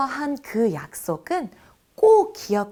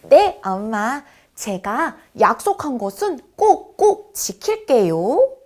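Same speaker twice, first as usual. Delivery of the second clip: lightly voiced child answering mom, louder; eager little promise to mother; obedient child; 2 semitones higher, 6 dB louder than usual. (엄마에게 씩씩하게 대답하는 아이 목소리)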